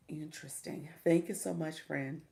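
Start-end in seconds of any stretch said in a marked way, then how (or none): 0.1-0.9 soft unvoiced whisper to herself; whispered aside. Real, not acted. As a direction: breathe it under the breath.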